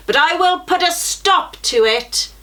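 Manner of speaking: the giant threatens in a rage; angry giant. A narrator's reading. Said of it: "answered the giant angrily".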